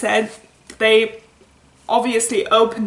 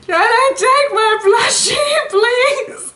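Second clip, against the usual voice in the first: high, loud, tearful pleading; crying plea; 12 semitones higher, 5 dB louder than usual. Retold or imitated. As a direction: beg through tears, childlike. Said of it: "I was like".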